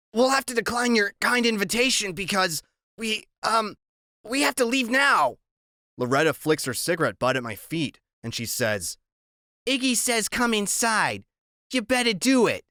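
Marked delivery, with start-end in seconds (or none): 0.1-5.4 put-on higher, nervous chattering boy; chattery high. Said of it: "his voice is chattery high". 9.7-12.7 tough woman voice. Acted. Loretta threatening.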